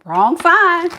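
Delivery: mocking sing-song. Mocking the person's delusion.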